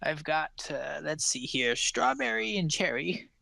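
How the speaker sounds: put-on high, quick old woman's voice, all grandmotherly charm; sweet old pie seller. Old woman offers pies to a traveller on the road, high sweet voice.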